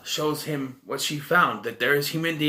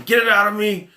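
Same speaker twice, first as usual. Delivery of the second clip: raised, high-pitched yell; retold yelling; 7 semitones higher, 6 dB louder than usual. Movie reviewer acting out the pregnant woman, yelling. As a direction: yell it out as her panicked plea. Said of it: "She's yelling at him".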